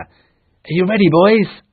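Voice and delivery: excited battle call; joyful call. Acted. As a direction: rouse the boys for battle. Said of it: "the joyful voice of Peter".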